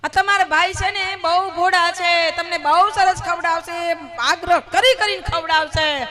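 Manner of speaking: urgent warning. Acted. Wife warns guests, acted.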